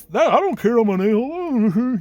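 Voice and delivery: high, drawn-out mock defiance; hammed-up, loud I-don't-care voice; mocked defiant salesman. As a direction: make fun of the uncaring salesman. Mocking a salesman who doesn't care.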